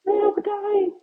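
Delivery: high squeaky victim voice; mock dying victims. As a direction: squeal it like a tiny dying person. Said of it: "They're like".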